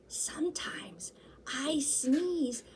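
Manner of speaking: high unvoiced whisper. Snail whispering a secret into Chew's ear. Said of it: "and whispered".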